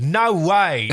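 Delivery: broad Aussie bogan exclamation; bogan Australian accent. Retold or imitated. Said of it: "in a really bogan Australian accent".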